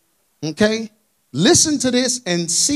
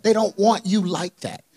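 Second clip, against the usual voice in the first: ordinary talk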